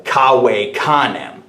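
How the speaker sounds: "Kawe kanem" slowly, V as W and C as K; Latin pronunciation. Shown as a demonstration. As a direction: say "Kawe kanem" as KAH-way KAH-nem. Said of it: "For example".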